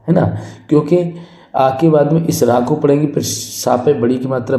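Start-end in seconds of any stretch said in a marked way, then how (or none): none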